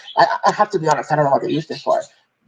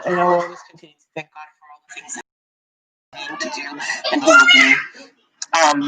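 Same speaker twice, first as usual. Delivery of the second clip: real emotional. Real, not acted. Mother thanking God for her child, emotional and fighting tears. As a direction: fight back tears while giving thanks. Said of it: "I don't wanna get emotional".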